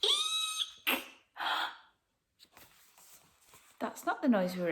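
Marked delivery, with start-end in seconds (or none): 0.0-1.0 very high tiny squeal; high frightened eek. Acted. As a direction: squeal it like a scared lion. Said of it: "let out an eek".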